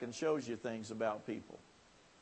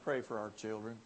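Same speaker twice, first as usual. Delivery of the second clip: quiet plea; 4 semitones lower, 6 dB quieter than usual. Retold or imitated. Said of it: "the heart cry of many people".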